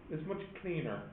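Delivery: ordinary delivery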